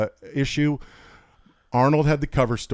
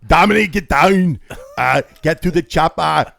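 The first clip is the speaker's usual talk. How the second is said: loud, high Austrian action-hero bark; hammed-up Arnold shouting; Arnold Schwarzenegger impression; 5 semitones higher, 8 dB louder than usual. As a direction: do a bad Arnold, yelling orders. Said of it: "my Arnold sucks".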